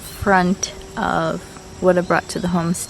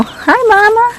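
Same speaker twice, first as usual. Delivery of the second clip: high cooing greeting to a hen; 13 semitones higher, 6 dB louder than usual. Real, not acted. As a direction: coo sweetly at the mother hen.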